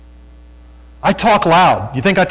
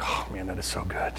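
very soft, low murmur; quiet, low aside of wonder; hushed, moved; 7 semitones lower, 14 dB quieter than usual. Real, not acted. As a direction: drop right down, deeply touched. Moved reaction to the videos.

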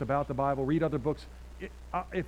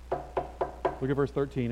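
normal talking voice

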